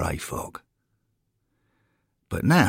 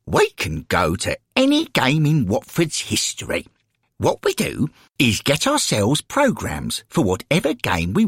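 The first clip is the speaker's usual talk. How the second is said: put-on higher, eager old lady's voice; enthusiastic elderly lady guide; 7 semitones higher, 3 dB louder than usual. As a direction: explain the wonders to a newcomer. Florence explains the afterlife.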